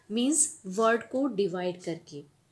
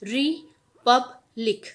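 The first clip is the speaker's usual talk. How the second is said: Republic drawn out slowly and a bit louder, each syllable clear; slow, louder teaching demo of Republic; 5 dB louder, much slower than usual. Shown as a demonstration. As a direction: say Republic slowly for a child to copy. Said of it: "तो हम कैसे बोलेंगे?".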